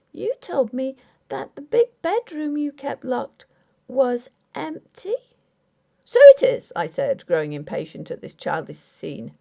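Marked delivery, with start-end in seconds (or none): 0.1-5.2 put-on high, pleading young wife voice; raised voice of a wounded young bride; young wife Grace. A narrator's reading. 6.0-6.7 sharp, much higher and louder snap; husband losing patience with his wife; impatient retort. A narrator's reading.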